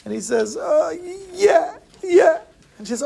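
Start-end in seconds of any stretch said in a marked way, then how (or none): 0.6-2.4 very high mock-crying child; acted weepy little boy; tearful boy. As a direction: whimper the answer through pretend tears. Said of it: "he starts to cry".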